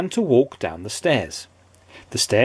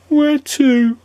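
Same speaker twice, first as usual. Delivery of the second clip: high and louder, squeaky and wary; 13 semitones higher, 5 dB louder than usual. A narrator's reading. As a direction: ask it glumly and squeaky. Mister Miserable asks, squeaky voice.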